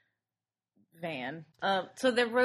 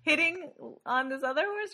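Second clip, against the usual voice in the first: laughing; 9 semitones higher, 8 dB louder than usual. Real, not acted. Laughing while talking.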